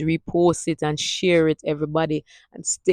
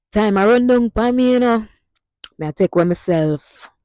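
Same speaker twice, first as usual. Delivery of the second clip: higher and louder start in Jamaican Patois; lively Patois sentence; 5 semitones higher, 5 dB louder than usual. Shown as a demonstration. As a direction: toss it off like a real Jamaican leaving. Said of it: "You can use it in this instance".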